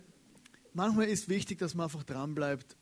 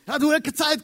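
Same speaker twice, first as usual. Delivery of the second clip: higher and louder, mock exasperated; mock exasperation; 10 semitones higher, 10 dB louder than usual. Preacher acting a friend who never has time, loud mock exasperation. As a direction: brush off the caller loudly.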